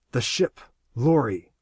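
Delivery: ordinary delivery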